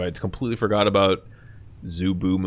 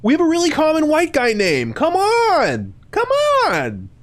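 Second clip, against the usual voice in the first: very high, loud squeal; piercing falsetto enthusiasm; overexcited greeting; 4 dB louder than usual. Retold or imitated. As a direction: squeal it like a giddy new best friend. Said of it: "I'm like, "Huh".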